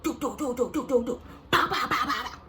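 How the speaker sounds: rapid-fire mock punch sounds; imitated punching noises. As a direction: rattle off cartoon hit effects.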